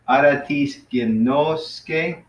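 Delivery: Aratis ginoske said slowly, syllable by syllable; half-speed teacher demo; slow careful model. Shown as a demonstration. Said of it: "'cause the accent's right here".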